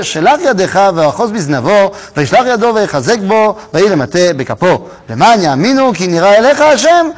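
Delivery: fast, loud Hebrew verse read; a quick, driving reading of the Hebrew text; rapid Torah reading. Acted. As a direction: rattle through the verses without translating.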